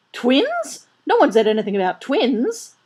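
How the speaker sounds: very high, loud incredulous protest; flabbergasted, voice leaping up; alarmed disbelief. A narrator's reading. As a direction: protest as the news sinks in. Father takes in news of twins.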